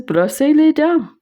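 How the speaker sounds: insistent repeat. Retold or imitated. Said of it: "She says".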